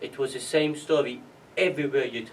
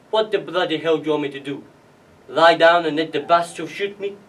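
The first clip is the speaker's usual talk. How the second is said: loud, sweary outburst building louder; acted angry father, getting louder; irate retort; 2 semitones higher, 9 dB louder than usual. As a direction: snap back, rising to a loud finish.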